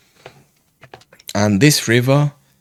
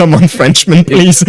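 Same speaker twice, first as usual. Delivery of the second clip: loud, raised-pitch mock call; imitated shout; 7 semitones higher, 10 dB louder than usual. Friend calling the fisherman off the rocks, mock shout. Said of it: "come and shout at me".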